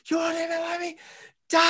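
high, mock anguished cry; retold scream at God. Man retelling screaming at God after surviving. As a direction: hurl it upward at God in disbelief. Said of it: "I was screaming at God".

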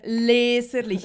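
a loud, slow, imitated bark. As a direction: bark the word slowly like the clerk. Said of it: "in Grossbuchstaben hat er mir das entgegengebellt". (laut und gedehnt entgegengebellt)

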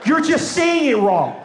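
high, emphatic false assurance; insistent friend. Acted. Friend insists with mischievous false reassurance.

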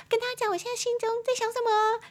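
very high, quick little-bird voice; chirpy bird voice. Pretend. Bird telling everyone its thoughts, high quick chirpy voice. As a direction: pretend to be a chattering bird.

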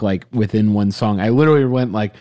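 ordinary delivery

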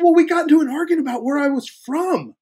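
high-pitched comic whine; retold distress. Actor explaining the argument to agent, mock distress. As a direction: protest up in a squeal.

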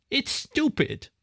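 high, thin, petulant squirrel voice; reedy squirrel boy. A narrator's reading. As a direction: whine it in a slim, reedy little voice. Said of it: "Roger's voice was slim and reedy".

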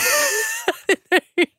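high squealing laugh; burst of laughter. Real, not acted. Reacting to bogan joke.

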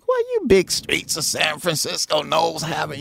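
high mock voice; squeaky impression of a friend; imitating bass player. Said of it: "stole that from another bass player".

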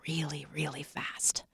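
sudden dramatic whisper. Acted. Speaker hushes a warning about comparisons, whispering. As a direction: drop to a hush on the last words. Things turn ugly, dropped to whisper.

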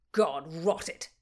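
loud angry outburst; exploding. A narrator's reading. Oxford losing patience in the poetry contest, exploding loudly. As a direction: burst out cursing. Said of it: "exploded Oxford".

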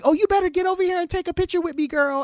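high, fast, loud calling out; excited call. Retold or imitated. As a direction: call her over with delight. Retold friend calling her over.

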